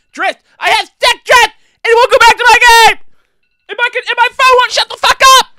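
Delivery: shrieking with rage over stick drift; furious screaming. Real, not acted. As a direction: scream it out in full fury at the controller. Raging at broken controller.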